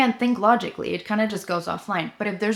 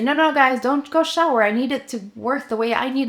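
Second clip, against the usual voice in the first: mock controlling yell; 6 semitones higher, 6 dB louder than usual. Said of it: "try to control them and say".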